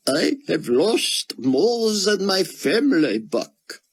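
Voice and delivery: a broken scholar clinging to scripture; grieving Israeli scholar. A narrator's reading. Grieving scholar tells Buck his faith, high and squeaked.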